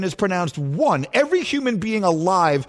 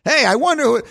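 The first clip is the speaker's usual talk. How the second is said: ordinary talk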